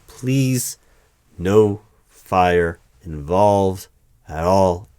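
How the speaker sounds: slow, loud, each word separated; firm warning. Acted. Interviewer warns a dragon off fire, slow and emphatic.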